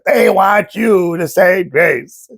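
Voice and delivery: slow, drawn-out movie-character imitation; mock exasperated explaining, very slow; Uncle Louis. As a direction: stretch each word for someone hard of hearing. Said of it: "Louis is like".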